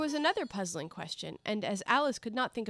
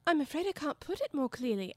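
put-on higher-pitched, polite young Alice; 7 semitones higher than usual. A narrator's reading. Alice answering the Caterpillar, very politely. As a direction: apologise politely in a raised voice. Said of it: "Alice replied very politely".

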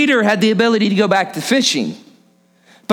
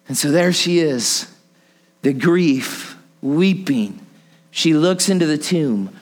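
subdued, reflective preaching; 6 semitones lower than usual. Real, not acted. Preacher picturing Mary weeping at the tomb, subdued. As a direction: lower voice, short phrases with pauses.

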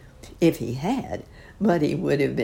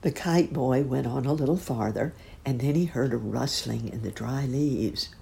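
plain reading voice

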